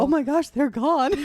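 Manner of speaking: high, loud mock astonishment; hammed-up amazed outburst; Home Alone moment. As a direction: imitate a kid finding the family gone. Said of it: "kinda had a Home Alone moment".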